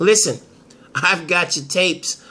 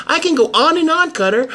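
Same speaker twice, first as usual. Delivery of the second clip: mocking listing; 10 semitones higher than usual. Taya lists charges.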